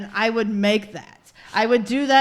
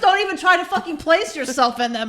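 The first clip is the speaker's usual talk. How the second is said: loud, raised-pitch warning; indignant telling-off; 7 semitones higher, 7 dB louder than usual. Real, not acted. Woman warning co-host off her fantasies, loud indignation. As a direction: order them out, fired up.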